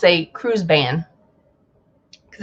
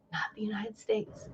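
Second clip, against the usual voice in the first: unvoiced whisper of the aside; whispered repeat; 19 dB quieter than usual. Mocking Norwegian whispering its snub of the US. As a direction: hiss it under your breath. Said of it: "Norwegian says".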